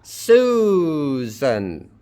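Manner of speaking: Susan, long drawn-out first syllable falling away; first-syllable stress. Shown as a demonstration. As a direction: stretch the SU of Susan, let the end drop. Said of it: "Stress on the first syllable".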